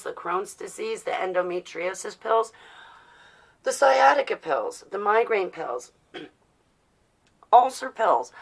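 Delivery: normal talking voice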